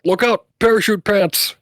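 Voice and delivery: high, comic warning cry; playful in-character holler; shouted warning. Acted. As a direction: call out a mock-urgent alert. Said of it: "shout out".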